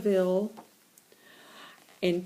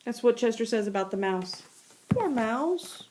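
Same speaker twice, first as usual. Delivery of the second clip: ordinary delivery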